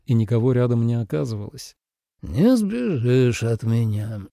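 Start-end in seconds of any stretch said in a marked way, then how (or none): 2.2-4.2 sleepy mumble. A narrator's reading. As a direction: mumble it half asleep, slowly. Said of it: "сонно пробормотал Ли Цзэ".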